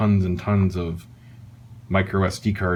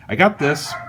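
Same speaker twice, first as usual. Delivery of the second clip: ordinary talk, nothing marked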